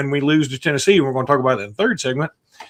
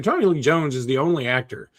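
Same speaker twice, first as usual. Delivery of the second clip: normal talking voice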